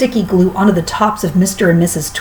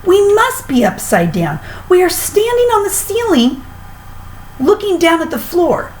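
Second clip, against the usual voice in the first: frightened husband; 11 semitones higher than usual. A narrator's reading. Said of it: "gasped Mister Twit".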